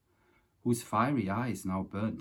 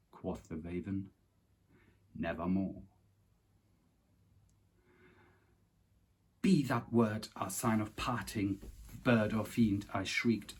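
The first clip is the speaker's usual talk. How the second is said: dramatic, measured reading of The Raven; smooth poem recital. Acted. Reciting Poe's Raven to viewers in a smooth dramatic voice. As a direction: recite it smooth and solemn, savouring each line. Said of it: "so that was the smooth one".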